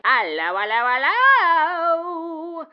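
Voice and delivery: slow, stretched sing-song hello; drawn-out greeting to the caller; showy phone greeting. Acted. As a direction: draw out each hello like a showman. Answering the phone theatrically.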